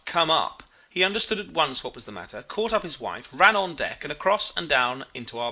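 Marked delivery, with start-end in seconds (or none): 0.1-0.6 mild mock call, slightly raised; retold shout. A narrator's reading. Narrator recalling his shout to the captain, half-strength call. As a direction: retell a shout at lowered force. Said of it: "when he heard my shout".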